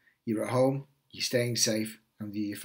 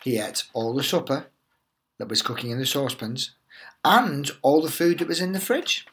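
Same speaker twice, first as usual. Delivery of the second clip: lively storybook read-aloud voice; animated teacher reading The Tiger That Came to Tea; reading to children; 6 dB louder than usual. Acted. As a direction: tell it like a bedtime picture book for little ones. Teacher reads picture book aloud.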